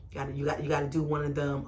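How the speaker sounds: normal talking voice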